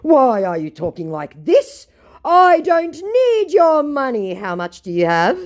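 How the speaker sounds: high, loud mock protest, slowing down; hammed-up wounded indignation; offended scammer. Scammer denies wanting her money, mock-offended dramatic voice. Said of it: "which caught his attention".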